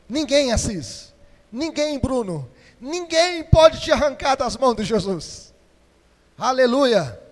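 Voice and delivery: loud and high, calling names out in rising fervour; fiery preaching shout. Real, not acted. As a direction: hammer it home to the whole church.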